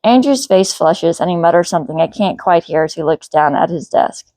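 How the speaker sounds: plain reading voice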